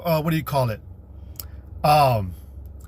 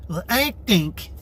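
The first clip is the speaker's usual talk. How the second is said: mock backtalking woman; slower than usual. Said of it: "You'd never backtalk him".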